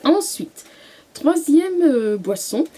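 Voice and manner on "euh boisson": normal talking voice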